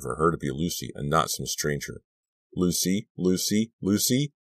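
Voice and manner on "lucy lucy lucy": rising, each call higher; desperate repetition. A narrator's reading. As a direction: insist on her name desperately. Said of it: "I repeated her name again and again".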